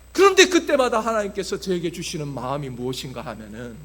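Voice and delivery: ordinary talk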